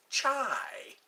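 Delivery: slow unvoiced whisper of "Chai"; a hushed whispered word. Shown as a demonstration.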